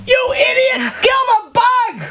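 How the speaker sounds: exasperated yell. Retold or imitated. Narrator yelling at the guide to cross the grass, exasperated. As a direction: yell at him like he's lost his mind. Furious at guide over bug.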